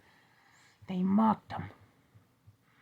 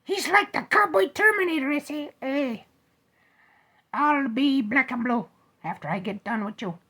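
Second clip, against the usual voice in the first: high mock cowboy; 11 semitones higher, 8 dB louder than usual. Teddy bear voices cowboy villain.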